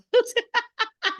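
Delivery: high laughing burst; real laugh. Real, not acted. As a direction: laugh as you step down. Laugh stepping off pedestal.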